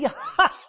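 high mocking cackle; devil's cackle. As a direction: laugh down at them. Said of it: "Laughing, going".

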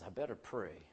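low and soft, inward; hushed resolve. Acted. Preacher voicing Jacob deciding to pray, low and hushed. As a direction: say it quietly to yourself.

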